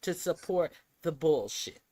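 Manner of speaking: loud angry rant. Real, not acted. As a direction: keep ranting, fired up. Heated rant continues.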